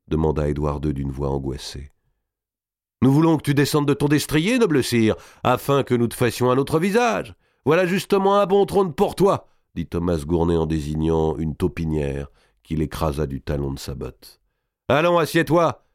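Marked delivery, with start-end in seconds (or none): 3.0-9.5 high, mock-courtly taunting; jeering henchman. A narrator's reading. 14.9-15.9 high, peremptory command; brusque order. A narrator's reading. Henchman orders captive to sit.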